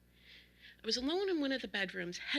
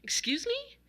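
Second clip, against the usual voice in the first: high, rising incredulous question; startled, questioning rise; taken aback; 14 semitones higher, 4 dB louder than usual. A narrator's reading. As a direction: let the pitch leap in disbelief. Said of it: "I was taken aback".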